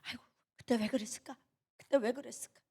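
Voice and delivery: soft regretful murmur; small, softened self-blame; sheepish regret. Retold or imitated. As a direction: mutter it to yourself in embarrassment. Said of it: "눈치 보고 도망 다니는".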